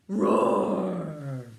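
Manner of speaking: big loud roar; dragon roar. Pretend. Parent leads child in a loud dragon roar.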